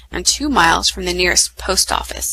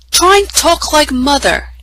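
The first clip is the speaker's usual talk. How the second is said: higher-pitched sibling jibe; irritable retort; 7 semitones higher, 4 dB louder than usual. Acted. Edmund to Susan about acting like mother, irritable retort. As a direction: snipe at a bossy sister. Edmund squabbles with his sister.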